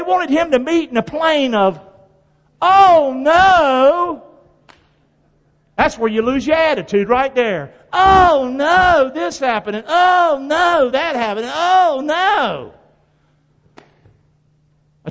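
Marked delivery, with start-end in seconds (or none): 2.6-4.2 higher, whining "Oh no"; mock whiny oh no. Preacher mocking a believer who loses focus, whining. 7.9-12.7 higher, stretched-out whining "oh no"s; mock whining. Preacher mocking complainers, slow and whiny.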